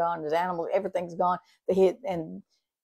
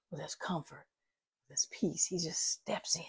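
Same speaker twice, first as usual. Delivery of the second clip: whisper; 11 dB quieter than usual. Real, not acted. Pastor hushes to a whisper.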